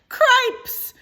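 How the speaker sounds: high, loud panicked mouse shout. Acted. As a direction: yell it out in alarm. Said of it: "shouted Furlow again".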